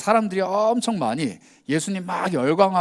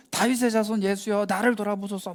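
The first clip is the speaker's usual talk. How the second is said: higher, pleading call from the roadside; crying out; 6 semitones higher than usual. Retold or imitated. Blind man calling to passing Jesus, higher pleading cry. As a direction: call out desperately to be noticed.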